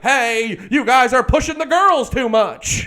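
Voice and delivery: whiny imitation of a singer stopping the show; scolding frontman. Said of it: "stop shows to be like".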